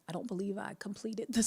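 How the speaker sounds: usual speaking voice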